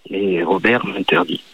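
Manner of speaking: low, slow man's voice. Acted. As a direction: drop into a deep male voice. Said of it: "une voix d'homme".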